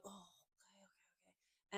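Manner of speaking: whispered, grudging okays; reluctant teen murmur. Retold or imitated. Reluctant teenage girls answering the photographer, whispered. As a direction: mumble it like a bored teen. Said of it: "they're like".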